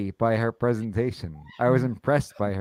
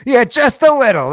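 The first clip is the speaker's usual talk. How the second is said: sarcastic; 12 semitones higher, 12 dB louder than usual. Acted. Sarcastic reply to the quote.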